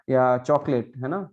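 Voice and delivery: usual speaking voice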